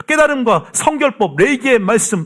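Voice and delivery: urgent, high-pitched exhortation; full-force preaching. Real, not acted. Preacher urging people to grasp the Word, in impassioned exhortation. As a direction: drive it home to the congregation, pitch held high.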